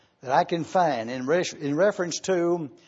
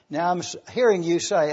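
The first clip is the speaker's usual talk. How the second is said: ordinary delivery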